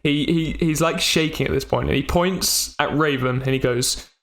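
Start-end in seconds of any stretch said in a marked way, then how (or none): none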